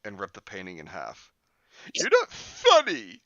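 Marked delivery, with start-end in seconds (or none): 1.9-3.2 very high, loud, slow shout; angry. Acted. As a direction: yell it right in his face. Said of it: "she cried angrily".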